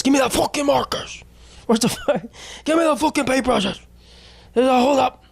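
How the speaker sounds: put-on high squealed child stick-up demand; marker kid as robber. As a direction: play a bratty kid holding up a shop. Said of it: "Future art store thief".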